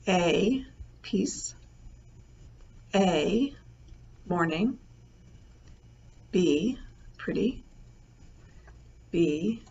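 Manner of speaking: slow, measured dictation of a test word list. Shown as a demonstration. Teacher reading a memory test list to her students, slow measured dictation. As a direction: read each word out clearly, pausing for students to write.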